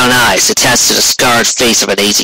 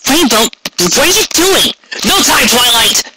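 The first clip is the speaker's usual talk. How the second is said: put-on much higher, alarmed young voice; startled Twilight; 13 semitones higher than usual. A narrator's reading. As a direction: caught off guard, then brushed aside. Twilight and Rainbow exchange on balcony.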